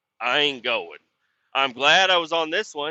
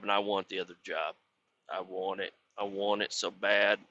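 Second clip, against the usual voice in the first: quiet longing; 5 semitones lower, 7 dB quieter than usual. Real, not acted. Wanting the job.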